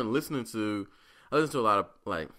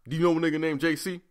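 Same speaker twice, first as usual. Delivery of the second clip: higher, louder, fast cop demand; mock cop voice; 8 semitones higher, 6 dB louder, faster than usual. Police calling him about the friend, loud mock cop. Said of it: "talking about".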